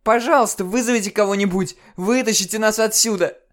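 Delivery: очень громко и высоко, умоляя; кричи в мольбе о спасении; панический крик. Acted. Мольба о помощи в динамик. (панический крик о помощи)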